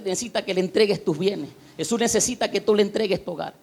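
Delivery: usual speaking voice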